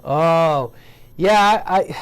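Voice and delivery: normal talking voice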